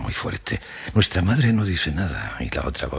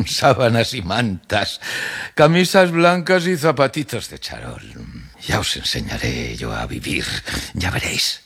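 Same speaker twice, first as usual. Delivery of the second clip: risa y voz muy aguda de vieja que se burla; abuela burlona; 8 semitones higher than usual. Acted. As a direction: remeda con sorna en agudo y baja para amenazar. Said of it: "La abuela se ríe muy fuerte". (abuela mofándose de los lujos de sus nietos)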